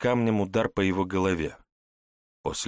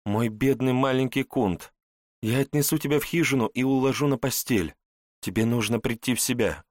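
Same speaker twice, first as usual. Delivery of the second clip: higher, gentle mothering voice; tender mother; 5 semitones higher than usual. Acted. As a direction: soothe the wounded child. Mother comforts wounded son.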